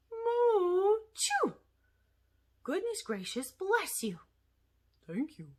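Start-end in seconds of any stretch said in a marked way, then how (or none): 0.1-1.5 mooing sneeze. Acted. Cow puppet sneezes.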